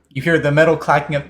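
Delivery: ordinary talk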